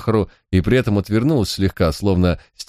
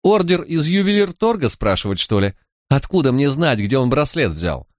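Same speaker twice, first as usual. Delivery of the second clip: put-on higher, indignant street-girl voice; a sulky young crook, pitched up and wronged; lightly voiced defiant girl, higher than the narrator; Manya, young thief; 8 semitones higher than usual. A narrator's reading.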